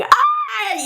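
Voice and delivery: high mock scream of a small boy; retold kid scream. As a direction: imitate the kid shrieking in pain. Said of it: "this kid is screaming".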